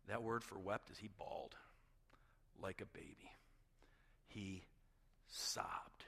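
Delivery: low, very soft, solemn delivery; hushed preaching. Real, not acted. Preacher telling congregation that Jesus wept, hushed and solemn. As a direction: let it sink almost to a whisper, with heavy pauses.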